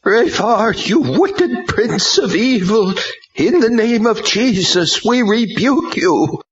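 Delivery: shouted rebuke. A narrator's reading. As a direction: hurl the rebuke at the demon. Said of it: "ready to shout it to the heavens".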